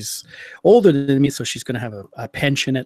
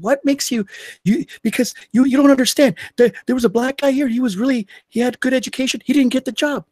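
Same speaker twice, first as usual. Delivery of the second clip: heated; 11 semitones higher than usual. Retold or imitated. Heated workplace argument quoted.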